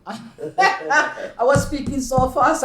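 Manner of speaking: slow, laughing through it. Real, not acted. Telling the interviewer about her fast talk, laughing. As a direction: chuckle while admitting it.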